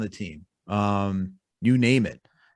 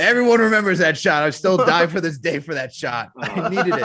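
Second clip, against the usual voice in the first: louder and higher, laughing at the memory; excited; 7 semitones higher, 5 dB louder than usual. Real, not acted. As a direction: relive the shot with laughing glee. Reliving Stoudemire shot, laughing.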